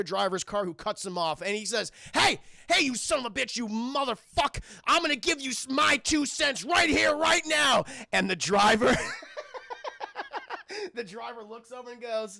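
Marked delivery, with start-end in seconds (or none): 2.1-7.9 loud, raised, threatening tirade; road rage. Acted. Charlie slamming the other driver's car, enraged. 9.0-10.9 high, soft sinister giggle; villain's chuckle. Acted. The other driver looking over at Charlie, sinister giggling.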